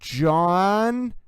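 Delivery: "John" held long on its final N; hanging N. Shown as a demonstration. Host showing how the N hangs, long "John". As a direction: let the N of JOHN hang. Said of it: "the N hangs".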